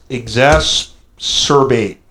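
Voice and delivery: normal talking voice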